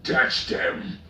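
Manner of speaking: loud orc yell; orc shout. A narrator's reading. An orc urging the charge, loud shout. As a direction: holler it at the enemy. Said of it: "one of the orcs shouted".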